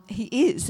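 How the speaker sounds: "is" punched and held; stress on IS. Shown as a demonstration. Pointing out the present tense, stress on "is". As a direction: land firmly on "is". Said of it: "It's present tense".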